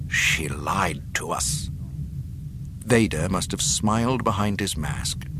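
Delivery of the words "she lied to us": slow, low, nearly whispered accusation; dark anger. A narrator's reading. Tarkin learning she lied, darkly hushed. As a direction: hush it slowly with menace. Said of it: "Tarkin's face darkened".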